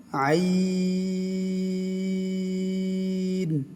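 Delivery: আইন held very long; long chanted আইন; drawn-out ain. Shown as a demonstration. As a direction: stretch আইন four alif lengths. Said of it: "চার আলিফ টান হবে".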